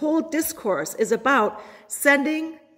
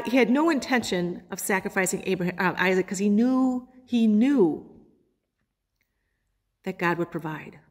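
ordinary talk, nothing marked

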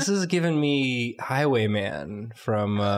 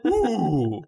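crowd ooh; 10 semitones higher, much slower than usual. Acted. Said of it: "Can I get a ooh".

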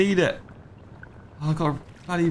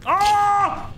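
terrified scream. Real, not acted. Gamer attacked by the tall creature, shrieking. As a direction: scream as it lunges.

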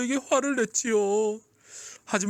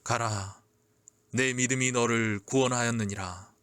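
much lower, solemn gentle voice; Jesus; 10 semitones lower, 3 dB quieter than usual. A narrator's reading. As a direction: pronounce it softly, deep and assured. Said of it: "예수님의 따스한 음성은". (낮고 따스하게 선언하는 음성)

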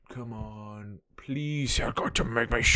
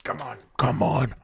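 ordinary delivery

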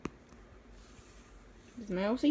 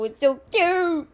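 high, slow and louder over the cute art; gushing; 7 semitones higher, 7 dB louder than usual. Real, not acted. Praising someone's OC to viewers: high, gushing delight. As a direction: melt over how cute it is.

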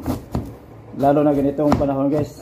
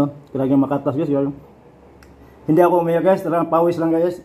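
chewing while talking; eating fruit between words; mouth full. Real, not acted. Seen 2: bites pineapple at 0.8s, chews with full cheeks throughout.

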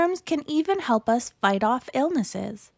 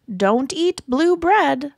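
slow, loud mould warning; 3 semitones higher, 5 dB louder, slower than usual. Acted. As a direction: bellow the warning slowly. Mold germ warns.

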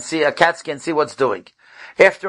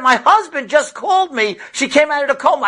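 overjoyed news; 9 semitones higher than usual. Retold or imitated. Mother reporting daughter woke.

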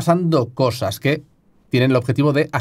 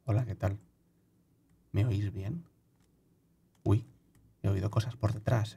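low, much softer murmur; hushed; 6 semitones lower, 15 dB quieter than usual. Real, not acted. Murmuring into the mic so the game won't hear. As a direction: keep it under your breath in the woods. Playing silently so the mic hears nothing.

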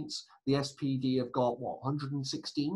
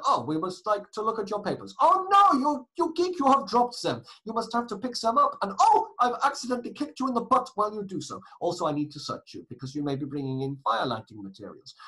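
high, loud, hammed-up German guard; bully them in a badly faked German accent; appalling German accent; 8 semitones higher, 7 dB louder than usual. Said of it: "That was an appalling German accent".

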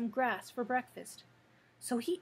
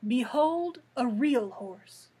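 louder, slow proud declaration; indignant boast; 7 dB louder, slower than usual. A narrator's reading. Cab horse showing himself to the sawhorse, slow loud indignant boast. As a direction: present yourself grandly, offended. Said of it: "Jim gave an indignant neigh".

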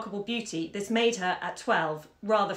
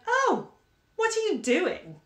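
put-on very high, drawn-out child's protest; gasp-like high 'oh', then slow reproach; dismayed surprise; 12 semitones higher, 4 dB louder, slower than usual. A narrator's reading. Daughter catching mother under the bed, dismayed surprise.

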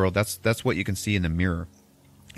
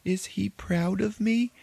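tearful plea; 11 semitones higher than usual. Retold or imitated. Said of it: "this woman is just crying".

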